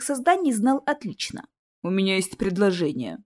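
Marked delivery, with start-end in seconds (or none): none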